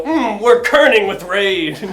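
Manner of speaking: loud, slow, strained comic anger; mock rage. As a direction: draw out the punchline in pretend fury. Fonts seethe in punchline.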